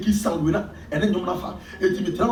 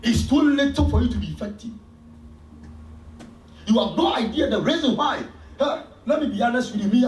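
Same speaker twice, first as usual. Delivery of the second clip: preaching; 6 semitones higher than usual. Real, not acted. Preaching at full force.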